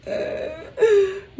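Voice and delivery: high-pitched helpless laugh; real laughter. Real, not acted. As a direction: crack up laughing. Cracks up at the story.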